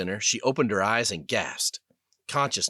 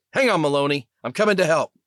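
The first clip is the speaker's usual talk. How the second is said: loud, higher urgent shout; urgent call; 7 semitones higher, 7 dB louder, faster than usual. Acted. Sergeant shouting to a pilot under fire, loud and urgent. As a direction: yell across the sky to reach her.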